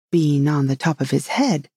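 plain reading voice

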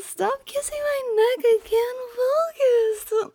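high, squirming mock protest; whiny squeal. Girlfriend distracted by neck kisses, high whiny protest. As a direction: whine it high, thrown off by kisses.

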